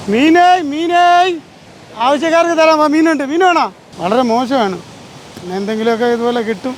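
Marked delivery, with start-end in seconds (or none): 0.1-3.7 loud hawker call. Real, not acted. Seller calling out fish.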